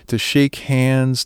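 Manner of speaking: usual speaking voice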